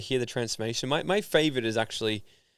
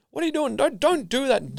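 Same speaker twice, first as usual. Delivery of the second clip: mock scolding father; 14 semitones higher, 5 dB louder than usual. Father scolding son over tech.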